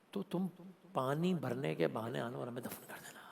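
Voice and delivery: hushed whisper, breaking inward; grieving whisper. Retold or imitated. Preacher voicing the Imam to tribe, whispered. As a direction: whisper it as if grief takes the voice. Imam asks women to bury them.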